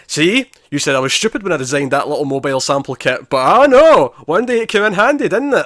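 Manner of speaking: high, loud mock I-told-you-so; Wynn's vindication. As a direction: crow in triumph, rising to a loud peak. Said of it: "and he's like".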